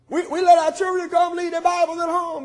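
preaching shout. Real, not acted. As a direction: call it out over the congregation. Preacher cries out to congregation.

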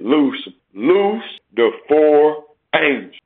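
loud, drawn-out biblical command; slow, booming pulpit proclamation. Acted. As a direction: proclaim the order slowly and forcefully. Voicing the command from the altar.

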